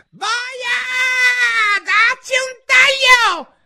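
shrieked very high and loud, slow; furious scream. Acted. La regina urla furiosa la condanna. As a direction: scream the order in rage. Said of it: "urlò".

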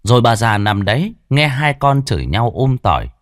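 usual speaking voice

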